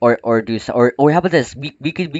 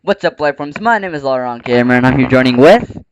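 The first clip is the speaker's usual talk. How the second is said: YouTuber intro; 4 semitones higher, 10 dB louder than usual. Acted.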